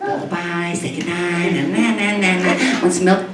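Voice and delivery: soft, sing-song fake sweetness; mock lullaby. Cat sweetly menacing its sleeping owner, soft sing-song. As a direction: coo it like a sinister lullaby.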